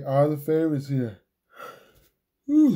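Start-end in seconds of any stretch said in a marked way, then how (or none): 2.5-2.7 hiccup. Real, not acted. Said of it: "I got the hiccups".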